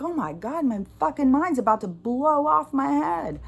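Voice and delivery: high-pitched exasperated outburst; exasperated venting. Acted. Releasing pent-up energy, exasperated venting. Voices need to release frustration.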